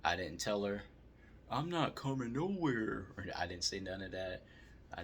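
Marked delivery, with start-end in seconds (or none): 1.5-3.1 higher, slow put-on snippy voice; a quick hammed-up comeback he never actually said; mock defiant retort. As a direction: toss off the imagined comeback, slowly and higher.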